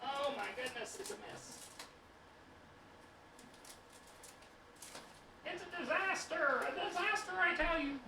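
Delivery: melodramatic lament. Pretend. Mock despair over a messy spill.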